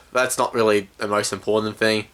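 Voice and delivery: ordinary talk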